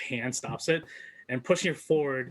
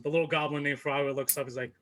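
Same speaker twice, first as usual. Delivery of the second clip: ordinary talk, nothing marked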